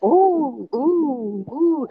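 mock gossipy coworkers cooing; a light gossipy coo; gossipy ooh. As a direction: tease like office gossips going ooh. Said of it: "it might be a little gossipy".

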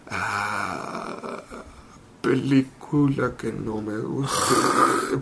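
slow, low, confused mumble; spaced-out Vin Diesel. Retold or imitated. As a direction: lose the thread mid-sentence, slowly. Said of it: "Y yo". (imitando a Vin Diesel ido, lento y grave)

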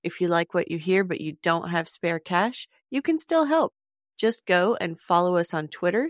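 usual speaking voice

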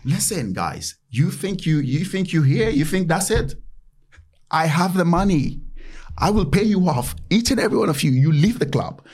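half-joking impression of a ranting club owner; imitating Roman Abramovich. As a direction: play the owner threatening to pay everyone off. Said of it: "once he told us, like".

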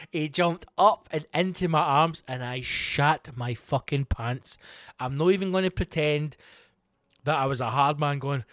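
ordinary delivery

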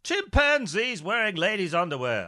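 pompous husband calling out; blustering gentleman. Acted. Rickett calls to his wife Bertha about his missing coat, blustering.

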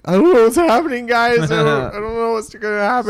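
high, panicky mock bureaucrat; clueless panicked voice. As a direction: squeak it out like a lost rule writer. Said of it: "the people typing the rule book are like".

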